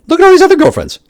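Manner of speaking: high, loud exasperated cry; loud outburst. Real, not acted. Ranting at those who wronged him, loud outburst. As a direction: throw it out in disbelief.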